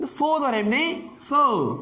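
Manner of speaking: "فو" pulled out alone and lifted high; single out "فو" and raise it; stressed syllable isolated. Shown as a demonstration. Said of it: "اینجا تیر دا خواندم من".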